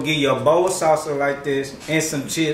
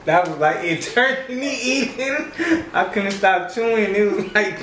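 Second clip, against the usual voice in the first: laughing; 7 semitones higher than usual. Real, not acted. Laughing after long chewing.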